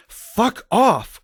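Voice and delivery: high, sharp mock outburst; angry dismissal. A narrator's reading. Two women rebuffing Dumbledore, angry dismissal. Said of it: "we both said".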